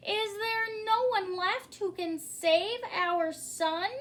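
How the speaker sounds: a frantic king begging his court for help; king's cry. A narrator's reading. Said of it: "cried the king".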